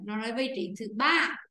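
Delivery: ordinary delivery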